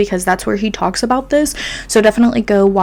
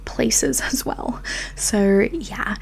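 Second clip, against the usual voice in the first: giggly whisper; 7 dB quieter, much slower than usual. Real, not acted. Trailing whispered aside.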